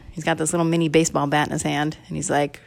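plain reading voice

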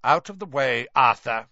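curt, icy order; cold, curt. Acted. Mister Crouch brushing Arthur aside, cold and curt. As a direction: dismiss him coldly. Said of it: "said a cold, curt voice".

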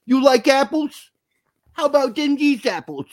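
loud, high-pitched Boston movie quote; mock Boston accent. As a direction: taunt in a hammed-up Boston accent. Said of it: "having to listen to the accents".

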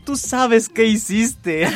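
slow, breaking into a laugh; laughing through the line; laughing movie line. Retold or imitated. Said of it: "Como en esa película".

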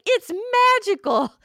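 high, slow, wide-eyed mock wonder; mock amazed. Playing her past self, gushing in mock awe at her phone. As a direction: gush it like you've seen magic.

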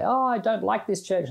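high, whiny mock grumble; lightly mimicking a fed-up member storming off; mock complaint. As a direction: poke fun at a petulant churchgoer. Mocking people who leave church.

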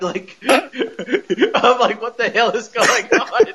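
high-pitched words breaking into real laughter; laughing. Real, not acted. Podcaster baffled by the Australian brother, laughing. Cracking up at the accent.

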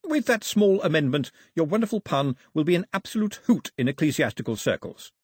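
high, polished courtier's voice; obliging courtier. Acted. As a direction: reassure a king with proper politeness.

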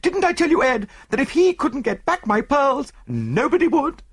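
high, loud, gushing woman; Mrs Opalsen. Acted. Wife reminds husband she was right, high and gushing. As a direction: crow in delight, high and loud.

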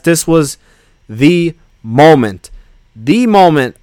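"the" said as a drawn-out THEE before "moment"; slow right down and lean on "the" in "the moment"; weighty pauses, stressing "the" each time; emphatic THE. Real, not acted. Hyping the show's best scene.

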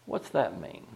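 ordinary talk

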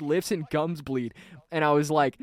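ordinary talk

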